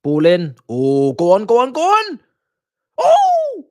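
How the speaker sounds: from loud urging up to a high, loud shout; building excitement. Real, not acted. Cheering a near goal during the watch-along, loud rising shouts. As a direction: urge the ball in, then yell when it nearly goes in.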